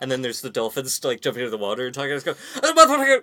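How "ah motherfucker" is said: high, loud garbled Scottish outburst; Scottish dolphin noise. Acted. Imitating a movie dolphin leaping from the water, Scottish noises. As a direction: squawk it like a mock-Scottish dolphin. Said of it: "Very Scottish noises".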